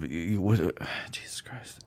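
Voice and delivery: muttered whisper to himself. Real, not acted. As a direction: breathe it under your breath. Losing track, muttering to himself.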